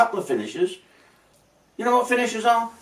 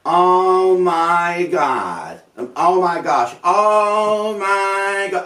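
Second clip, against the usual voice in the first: drawn-out, louder gushing "oh my God"; slow, stretched mock gasps from the yacht guests; 3 semitones higher, 4 dB louder, much slower than usual. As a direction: ham up reality-show guests squealing. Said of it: "all the guests on there".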